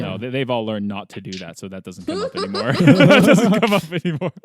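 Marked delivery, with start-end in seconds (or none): 2.7-4.3 loud, high, drawn-out laughing words. Real, not acted. Podcaster amused by the old habit, laughing as she talks. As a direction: repeat it through real laughter. Laughs while repeating the line.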